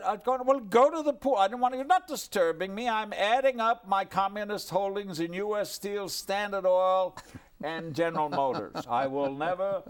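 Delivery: high, loud, brisk impersonation; imitating Chaplin. Chaplin telling his guest to go to the pool, high and loud.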